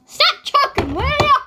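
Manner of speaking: skit character scolding. Pretend. Girl scolds in skit role.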